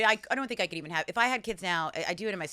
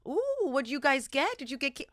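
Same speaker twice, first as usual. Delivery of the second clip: high, gushing candy excitement; acting out a wide-eyed reaction to candy; excited candy envy; 6 semitones higher than usual. Visiting friends and eyeing their kids' candy, acted excited gush. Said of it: "and I was like".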